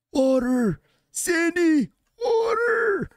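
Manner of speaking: put-on high, cracking old man shouting; a frail old man yelling for water; old person voice. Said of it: "why is that an old person voice".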